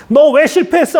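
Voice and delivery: high-pitched sharp reprimand; scolding. Acted. Imagined harsh God to someone who failed, scolding. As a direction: tell them off for failing. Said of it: "야단치는 하나님이 아니라".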